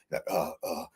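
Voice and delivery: normal talking voice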